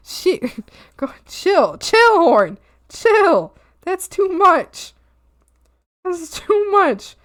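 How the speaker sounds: high, drawn-out mock pleading; playful protest. Streamer telling a too-big horn to chill, playful protest. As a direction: plead upward, stretching the words.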